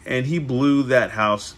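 slow, drawn-out storybook reading; storybook narration. A narrator's reading. Wolf threatens to blow the house in, slow storybook narration. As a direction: stretch the huffing and puffing for effect.

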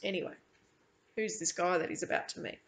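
ordinary delivery, nothing marked

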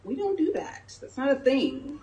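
high-pitched mock disapproval; dismissive friends. Friends questioning her move to Redondo, mock dismissive. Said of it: "that was the sentiment".

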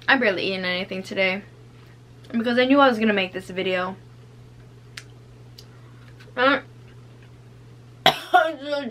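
chewing while talking; speaking around food; mouth full. Real, not acted. Seen 0: Holds cookie throughout, bites near +2.4, chews while talking after.

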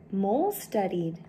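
usual speaking voice

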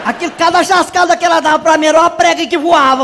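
ordinary delivery

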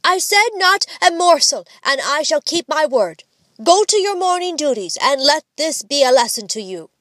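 high, firm mock-father scolding; stern decree. A narrator's reading. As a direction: refuse firmly, as the strict father. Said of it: "this stern decree".

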